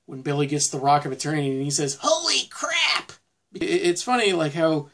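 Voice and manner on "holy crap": high, drawn-out exclamation; imitating a stunned outburst; mock astonishment. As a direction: stretch both words high in amazement. Acting out Billy's astonished exclamation.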